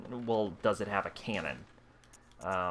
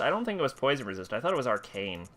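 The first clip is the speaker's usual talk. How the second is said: ordinary talk, nothing marked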